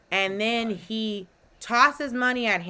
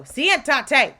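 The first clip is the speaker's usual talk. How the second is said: louder, higher Spanish command; imitated dog command; 6 semitones higher, 7 dB louder than usual. Owner trying Spanish on his dog, imitated loud command.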